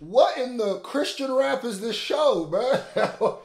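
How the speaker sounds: high, loud, laughing exclamation; laughing disbelief. Real, not acted. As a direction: blurt it out incredulously through laughter. Stunned, amused reaction to the show.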